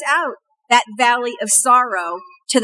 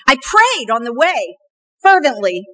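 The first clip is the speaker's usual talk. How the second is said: ordinary delivery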